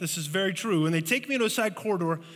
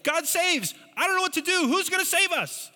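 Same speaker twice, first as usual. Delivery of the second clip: panicked Ahaz; 12 semitones higher than usual. Acted. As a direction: cry out in fear to God Saves. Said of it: "and he says".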